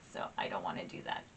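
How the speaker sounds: a quick, fast whisper after a laugh; whispered aside. Real, not acted. Joking to viewers about her red nose, whispered. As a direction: drop to a whisper, like a secret.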